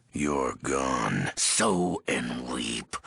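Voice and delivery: ordinary talk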